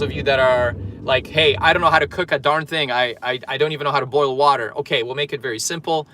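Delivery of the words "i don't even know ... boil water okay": usual speaking voice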